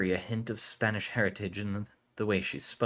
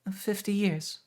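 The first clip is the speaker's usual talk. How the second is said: much higher, stiff and formal; stiff young woman; 13 semitones higher, slower than usual. Acted. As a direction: apologize stiffly, high. Aurelia answers.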